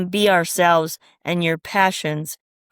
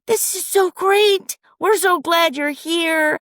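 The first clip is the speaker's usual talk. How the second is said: gushing supporters; 10 semitones higher, slower than usual. Retold or imitated. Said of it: "they're all saying".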